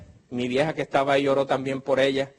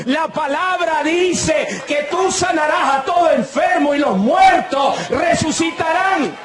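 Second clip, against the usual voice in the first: rising to a slow, shouted higher proclamation; preaching at full force; 9 semitones higher, 4 dB louder, slower than usual. Acted. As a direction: thunder it out from the pulpit. Predicando a toda fuerza. (predicación a gritos, lenta y enfática)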